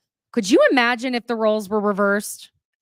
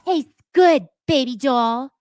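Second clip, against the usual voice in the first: high, louder mock of a smug purr; mocking Jen; 5 semitones higher, 5 dB louder than usual. Narrator mimicking Jen taunting Danielle, mock sultry. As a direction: sneer it like a smug flirt. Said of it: "immediately Jen goes".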